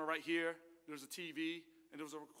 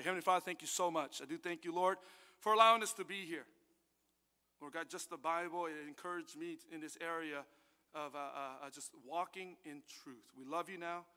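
soft, low closing prayer; hushed prayer to close; 4 semitones lower, 5 dB quieter than usual. Acted. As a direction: pray it quietly and humbly.

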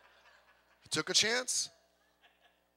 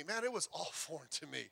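laughing, softer; 6 semitones lower, 12 dB quieter than usual. Real, not acted. Pastor admitting church felt strange, laughing softly. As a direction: repeat it, softer, laughing mid-line.

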